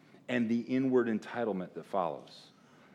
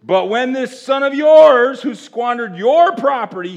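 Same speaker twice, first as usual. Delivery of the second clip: put-on angry older son; 12 semitones higher, 15 dB louder than usual. Quoting the older son angrily.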